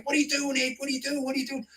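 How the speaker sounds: high-pitched, mock-panicked calls of "what are you doing"; mock radio scream. Mates calling him on the radio, mock screaming. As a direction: play the mates yelling over the radio. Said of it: "They scream on the radio".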